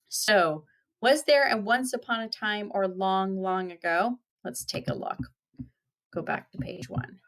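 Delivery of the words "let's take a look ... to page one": whispered aside; whispering. Real, not acted. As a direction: whisper it to yourself. Aside while turning pages.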